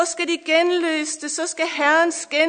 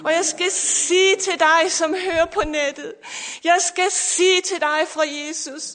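building, louder sermon cadence over music; fervent preaching; 2 semitones higher, 4 dB louder than usual. Acted. Pastor preaching to listeners online, fervent and rising.

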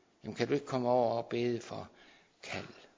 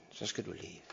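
softer reply from God; 2 semitones lower, 9 dB quieter than usual. Retold or imitated. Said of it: "Og Gud sagde".

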